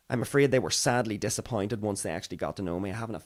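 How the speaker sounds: usual speaking voice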